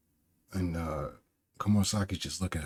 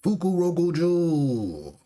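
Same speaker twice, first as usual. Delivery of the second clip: high, slow bellow of a name; drawn-out scream; 9 semitones higher, 4 dB louder, much slower than usual. Acted. Orochi screams for the missing ninja, long high yell. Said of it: "screams Orochi".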